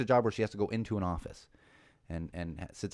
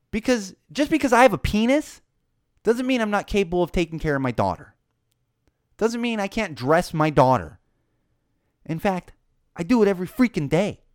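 loud, raised-pitch exasperation; indignant rant; 8 semitones higher, 6 dB louder than usual. Real, not acted. Dad defending his ability to dress his daughter, indignant. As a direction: hammer it out, fed up and defensive. Ranting about being underestimated as dad.

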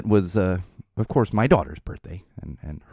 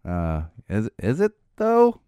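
sarcastic; slower than usual. Retold or imitated. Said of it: "I'm like".

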